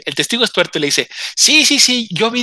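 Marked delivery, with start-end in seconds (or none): 1.4-2.4 high, louder eager yes yes yes; eager witness. Retold or imitated. One-eyed witness eagerly backs the inspector, high and keen.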